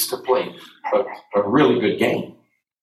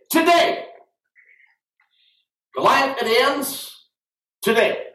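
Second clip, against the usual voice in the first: shouted today; 11 semitones higher than usual. Shown as a demonstration. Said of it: "say today".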